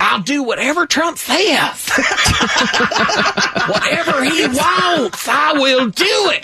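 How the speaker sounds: high, hammed-up Southern politician; mock a fawning Southern senator in a high voice; Lindsey Graham. Host parodying a senator pledging loyalty to Trump, high camp Southern. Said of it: "You listen to Lindsey Graham or someone".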